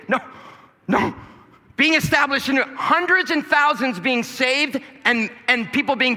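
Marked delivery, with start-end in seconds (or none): none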